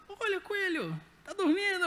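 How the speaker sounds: a hint of a curious four-year-old; imitating small child. Small son finds dead rabbit.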